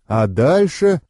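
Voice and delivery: ordinary delivery, nothing marked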